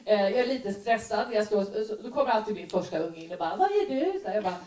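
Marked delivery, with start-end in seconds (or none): 3.5-4.2 put-on higher curious kid voice; child asking. Daughter interrupting mom's makeup, high and curious. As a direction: ask like a nosy little daughter.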